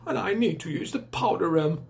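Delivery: lightly voiced, frightfully posh old lady; a hint of a grand, upper-class dame. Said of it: "Miss Trifle was frightfully posh".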